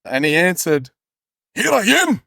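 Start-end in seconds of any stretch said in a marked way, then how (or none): 1.6-2.2 put-on old man voice. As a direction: croak it like a frail blind elder. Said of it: "do an old man voice now".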